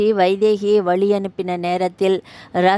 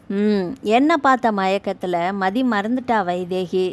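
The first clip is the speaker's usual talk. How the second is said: ordinary delivery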